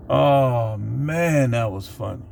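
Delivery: ordinary talk, nothing marked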